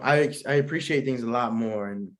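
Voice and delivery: ordinary talk, nothing marked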